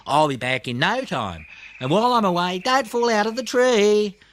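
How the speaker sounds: rising high, big-brother warning; cheerful, a bit bossy farewell; bossy little koala. A narrator's reading. Blinky telling Snubby to stay safe, rising and bossy.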